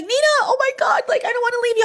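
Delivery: very high, mock-tearful pleading; sister's worried whine. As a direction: imitate the sister torn about leaving. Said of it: "She was like".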